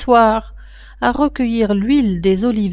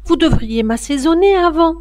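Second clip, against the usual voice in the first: put-on girl crying out; 8 semitones higher than usual. A narrator's reading. Wardé crying out to the ogress, high and urgent. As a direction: stop her before it is too late. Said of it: "Wardé s'écria".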